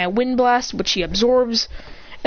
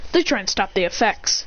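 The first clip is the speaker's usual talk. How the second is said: plain reading voice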